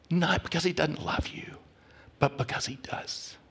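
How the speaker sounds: a whispered promise, voice fading to almost nothing; hushed whisper. Real, not acted. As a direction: whisper it intimately to the congregation. Preacher drops to hushed climax.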